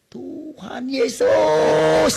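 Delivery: loud, high, long drawn-out cry; teriak. Retold or imitated. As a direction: shout it out, building and holding the last syllable. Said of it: "saya teriak". (teriakan lantang dan panjang)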